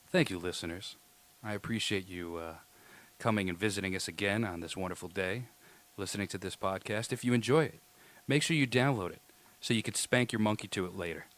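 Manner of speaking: loving closing to listeners turned creepy and sensual. Shown as a demonstration. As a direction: croon it like a creep trying to be loving. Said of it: "not creepy".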